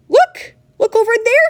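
very high, loud shriek; piercing child's scream; excited scream. A narrator's reading. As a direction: scream it to make everyone look. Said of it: "she screamed".

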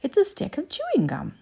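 high, thrilled exclamation; gleeful girl's high outburst; excited yell. Acted. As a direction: exclaim it excitedly, pitched well up. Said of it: "let out a yell of excitement".

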